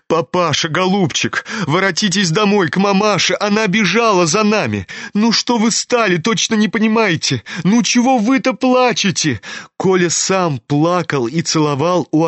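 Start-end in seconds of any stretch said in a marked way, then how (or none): 0.1-9.4 put-on higher, urgent boy's voice; pleading teenage son. A narrator's reading. Kolya begs his father to come home, pleading. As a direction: beg your father, quickening and rising.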